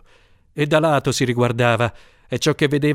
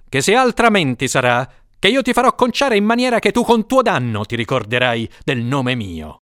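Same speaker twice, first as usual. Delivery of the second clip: a touch of a stern lord, higher; threatening lord; 6 semitones higher than usual. A narrator's reading. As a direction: swear the threat with mild menace.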